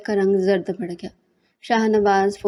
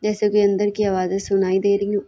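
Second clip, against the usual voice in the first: ordinary delivery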